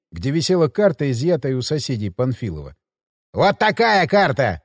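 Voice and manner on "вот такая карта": mocking thug. Burglar shows the map mockingly.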